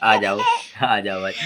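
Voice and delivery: ordinary talk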